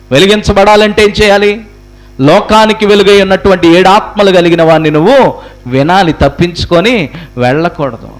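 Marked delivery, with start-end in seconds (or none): none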